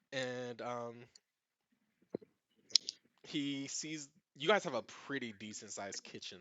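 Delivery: usual speaking voice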